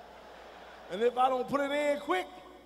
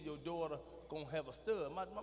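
ordinary talk, nothing marked

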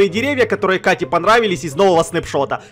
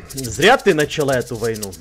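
menacing whisper; 10 semitones lower, 5 dB quieter than usual. Acted. Threatening rival quietly.

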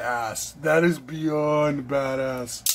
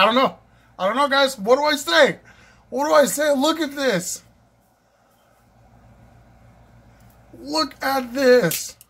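high, loud, giddy babble; can't contain yourself; overwhelmed excitement; 8 semitones higher, 4 dB louder than usual. Real, not acted. Overwhelmed while showing viewers the knife, high and giddy.

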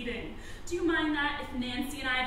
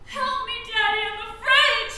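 higher, pleading daughter's voice; frightened child; 5 semitones higher, 3 dB louder than usual. Pretend. Acting frightened daughter calling to Daddy, afraid. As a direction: play a scared child calling for help. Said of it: "she's saying".

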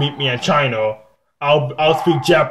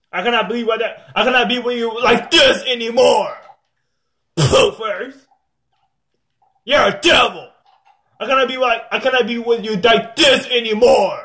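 high-pitched, worked-up rant; angry rant; 9 semitones higher than usual. Real, not acted. Player breaking off with a devil, high angry rant. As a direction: rant angrily with the voice pushed up high. Ranting at someone called a devil.

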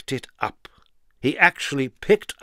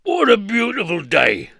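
bright, higher, louder strongman voice; cheerful Mister Strong; 8 semitones higher, 5 dB louder than usual. Acted. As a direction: beam it out happily. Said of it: "he thought to himself".

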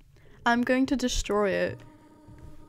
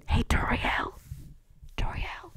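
whispered teasing secret; creepy whisper; 3 dB louder than usual. Acted. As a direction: hush it like a spooky secret.

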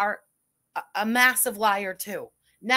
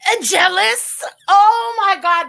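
loud, high, slow mocking exclamation; mocking outburst; 7 semitones higher, 11 dB louder, slower than usual. Real, not acted. Streamer mocking the rival, loud slow exclamation. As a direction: drag out the Oh my God loudly.

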